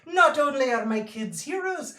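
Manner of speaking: high, gushing proud mom voice; proud mother. Acted. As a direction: brag about your kids like a doting mother. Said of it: "She's talking about how proud she is".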